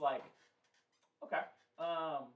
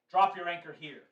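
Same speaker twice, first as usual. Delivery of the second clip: elven soldier calling down, louder and higher; shouting down; 4 semitones higher, 12 dB louder than usual. Acted. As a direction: call it down to the ship as an order. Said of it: "a voice shouting down from you".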